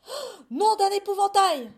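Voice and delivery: very loud, high outburst; gasp, then bellow the curse; horrified yell. A narrator's reading. Farmer finding mice in his field, yelling. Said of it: "hurla Monsieur Legrand".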